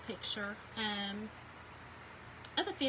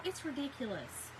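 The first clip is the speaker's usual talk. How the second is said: ordinary delivery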